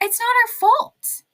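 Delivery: high, loud mock complaint; mock protest. Mock retort to Trump's complaint.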